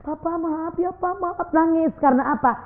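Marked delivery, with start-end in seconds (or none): 0.0-1.5 high, soft, tearful apology; wife apologising to her husband, crying softly. Acted. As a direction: plead through tears as the sorry wife. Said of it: "Langsung nangis itu istri".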